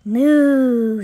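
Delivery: long, loud moo; cow moo. Acted. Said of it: "says the cow".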